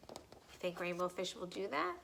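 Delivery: plain reading voice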